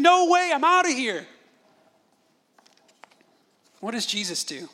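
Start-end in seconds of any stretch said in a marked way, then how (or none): 0.0-1.3 put-on high, loud protest of a kid; mock screaming. Dad imitating his son fleeing the spanking, kicking and screaming. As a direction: imitate a child yelling as he bolts away. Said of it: "He went kicking and screaming".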